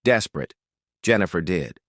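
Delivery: ordinary delivery, nothing marked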